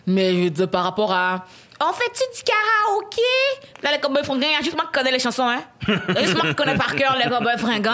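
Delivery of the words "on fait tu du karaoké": voix aiguë de collègue. Retold or imitated. Imite des collègues blancs.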